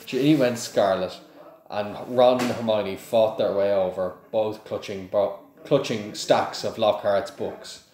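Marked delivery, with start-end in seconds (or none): none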